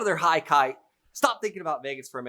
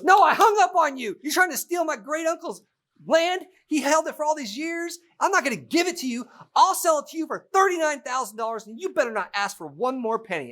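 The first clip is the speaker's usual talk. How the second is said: much higher, louder mock indignation; angry seller; 14 semitones higher, 4 dB louder than usual. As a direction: act the seller scolding the buyer. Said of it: "So Lisa goes".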